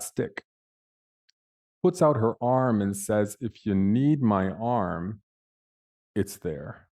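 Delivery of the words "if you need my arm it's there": sincere offer. Retold or imitated. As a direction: offer help gently, without hurry. Susan kindly offers her arm.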